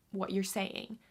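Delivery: ordinary talk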